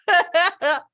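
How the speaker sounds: high, loud burst of laughter; real laugh. Real, not acted. Laughing at having taken the call after crying, real laugh. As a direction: laugh out loud in disbelief at yourself. Laughs at her own story.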